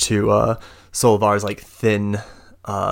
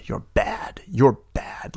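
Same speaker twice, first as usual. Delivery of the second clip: whisper; 6 semitones higher, slower than usual. Acted. Caged elf pleading to be freed.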